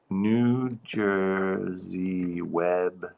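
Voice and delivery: slowly spelling out the name, fading to a hush; drawn-out careful read of the name; slow careful naming. Real, not acted. Checking the festival name.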